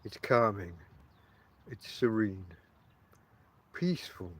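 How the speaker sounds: low, soft, serene voiceover; calming voice. Shown as a demonstration. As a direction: soothe like waves on rocks. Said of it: "I gave it a little bit of that in the voice".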